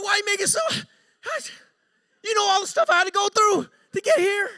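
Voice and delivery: high, loud mock wail; exasperated outburst. Coworker complaining about all he went through, exasperated. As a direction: wail the complaint at full volume.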